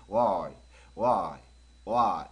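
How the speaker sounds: Y drawn out as a ringing glide from W; resonant Y. Shown as a demonstration. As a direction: let Y resonate with the mouth barely open. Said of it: "울림 소리를 가지고".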